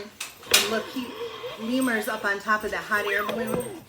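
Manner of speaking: ordinary delivery, nothing marked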